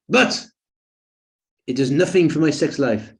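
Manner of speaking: lightly voiced French client, high start on But; a hint of the French client's accent, complaining; French accent impression. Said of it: "an impersonation".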